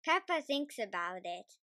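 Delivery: ordinary delivery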